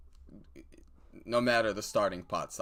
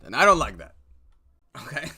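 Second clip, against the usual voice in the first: mock indignant; 5 semitones higher, 11 dB louder, faster than usual. Real, not acted. Mock outrage after encouragement.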